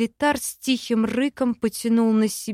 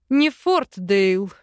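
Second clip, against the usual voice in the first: slow, louder laughing correction; chuckling; 2 semitones lower, 3 dB louder, slower than usual. Acted. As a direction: laugh it off teasingly. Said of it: "хохотнул Арван".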